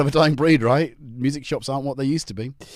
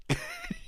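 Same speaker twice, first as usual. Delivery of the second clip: high giggle; 9 dB quieter than usual. Real, not acted. Friend scolding the chat.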